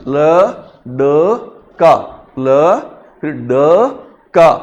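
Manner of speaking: ल, ड, का sounded apart with pauses; syllable by syllable. Shown as a demonstration. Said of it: "ड को ही दीर्घ पढ़ना".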